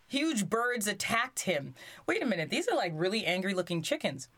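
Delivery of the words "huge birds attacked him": plain reading voice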